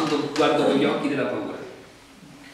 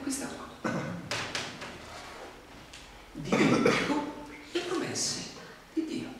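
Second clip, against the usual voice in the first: whispered reveal; 9 semitones higher, 9 dB quieter than usual. Real, not acted. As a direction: lean in and reveal the secret. Dramatic reveal of root cause. (rivelazione sussurrata e lenta)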